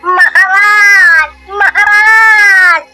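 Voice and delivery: long drawn-out tearful call; wailing cry. Acted. Villager running to the palace for help, wailing. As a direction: wail for the king from afar. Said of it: "महल में रोता चिल्लाता हुआ आता".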